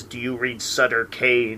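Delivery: low, slow, softened eerie line; creepy voice. Retold or imitated. Film fan quoting a possessed axeman's question, creepy voice. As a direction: imitate an eerie movie villain, hushed and drawn out. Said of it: "in this creepy voice".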